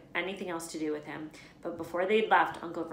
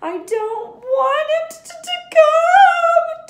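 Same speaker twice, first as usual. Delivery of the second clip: high, loud fake wailing; hammed-up fake sob; pretend sobbing yell; 18 dB louder, much slower than usual. A narrator's reading. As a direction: spoilt boy fake-crying to get his way. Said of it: "Dudley yelled between huge pretend sobs".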